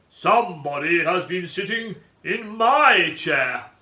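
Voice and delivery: loud, slow Father Bear growl; big growly bear. A narrator's reading. Father Bear finding someone sat in his chair, big growl. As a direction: boom it out slowly as a great growling bear. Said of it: "growled in a big growly voice".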